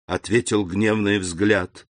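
usual speaking voice

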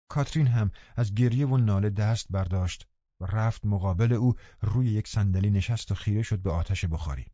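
ordinary delivery, nothing marked

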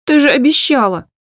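high loud accusation; reproachful cry. Acted. Hanna accusing her mother, high and loud.

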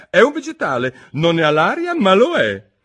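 proud of her discovery; Alice eager. A narrator's reading.

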